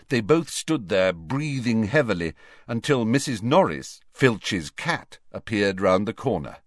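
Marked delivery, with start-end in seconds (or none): none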